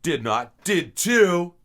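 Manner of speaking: high, loud copied Canadian retort; Canadian phrase. Retold or imitated. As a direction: mimic the playground comeback. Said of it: "reasons to say".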